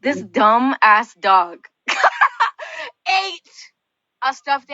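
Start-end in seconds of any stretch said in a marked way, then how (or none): 1.9-3.0 high burst of laughter mid-sentence. Real, not acted. Laughs at dumb dog.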